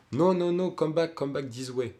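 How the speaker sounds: put-on a passenger shouted back in a strong French accent; French accent English. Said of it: "un accent français, euh, assez caractéristique".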